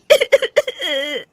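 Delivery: a short broken laugh; bitter laugh. Acted. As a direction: laugh bitterly at her own fate. Bitter laugh after confession.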